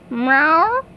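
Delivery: low, odd-voiced cartoon meow; a low pet snail meowing back. Pet snail answers the breakfast call with a low meow.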